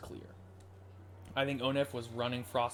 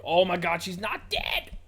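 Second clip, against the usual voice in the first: very loud, high-pitched disbelief; explosive high excited yell; real overjoyed shout; 7 semitones higher, 9 dB louder, slower than usual. Real, not acted. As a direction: burst out yelling in amazed relief. Player learns character survived.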